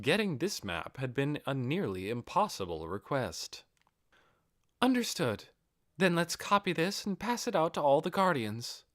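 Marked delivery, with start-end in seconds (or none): 4.8-8.8 higher, devoted female aide voice; Albedo's voice. Acted. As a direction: answer her master eagerly, pitched up.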